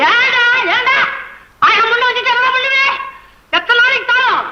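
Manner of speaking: high wailing self-pity; wailing lament. Acted. Poet wails alone, self-pity.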